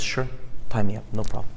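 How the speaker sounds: low, soft, resigned go-ahead; quiet acquiescence. Acted. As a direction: give in quietly, voice dropped. Jesus meekly accepting being bound.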